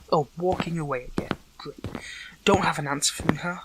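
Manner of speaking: loud, bitter challenge; angry taunt. Acted. Calling after someone walking away, angry taunt. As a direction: throw it loudly after them. Other person walks away without answering.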